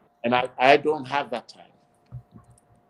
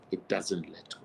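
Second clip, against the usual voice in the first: ordinary talk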